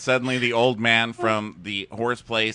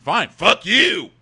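louder, slow hammed-up snap; mock angry snap; 7 semitones higher, 8 dB louder, slower than usual. Friend voicing dumped guy storming off, mock angry and loud. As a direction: spit it out like a sore loser. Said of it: "And he's like".

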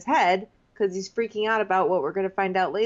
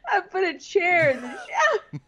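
lightly voiced old man, breaking into laughter; mock old-timer, laughing; 5 semitones higher than usual. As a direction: play the old worker while cracking up. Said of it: "old man construction worker".

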